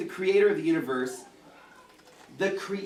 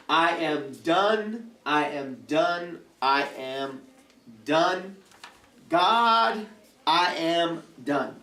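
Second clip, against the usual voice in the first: very slow, drawn-out exasperated declaration; exasperated, word-by-word acted complaint; stressed venting; much slower than usual. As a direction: stretch every word, fed up with it all. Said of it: "especially when I'm really stressed".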